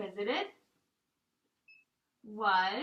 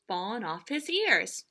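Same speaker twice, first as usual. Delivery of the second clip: plain reading voice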